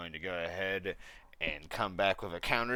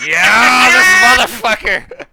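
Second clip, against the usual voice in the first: loud triumphant shout; 13 semitones higher, 24 dB louder, slower than usual. Real, not acted. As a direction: yell in victory at the dice. Player cheers saved roll.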